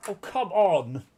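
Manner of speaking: loud, high exasperated outburst; exasperated protest. Retold or imitated. Gamer fed up with password rules, exasperated.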